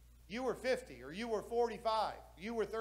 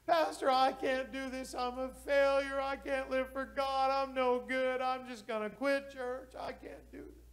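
imitating a crying man; 6 semitones higher than usual. Alan crying to pastor.

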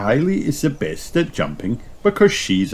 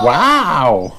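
higher, louder wow; amazed gasp; 7 semitones higher, 5 dB louder than usual. A narrator's reading. Peppa and George watch Kylie jump, amazed. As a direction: marvel out loud, pitched up. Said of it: "gasp Peppa and George, amazed".

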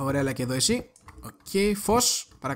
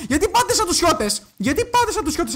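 raised, startled squawk of protest; high-pitched exclamation; 12 semitones higher, 4 dB louder than usual. Real, not acted. Player heckles two mobs going in a pair, high exclamation. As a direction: protest high and loud at the surprise.

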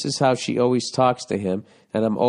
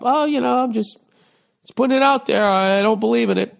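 much higher, louder, breezy put-on voice; mock airy girlfriend; 10 semitones higher, 3 dB louder than usual. The girlfriend explaining herself to the caller, mock high voice. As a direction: play her as innocently dodging.

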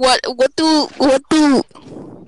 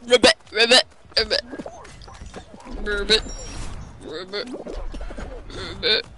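mock frog ribbiting, fading softer; frog croak; 2 semitones lower, 9 dB quieter than usual. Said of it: "bears sound like a frog".

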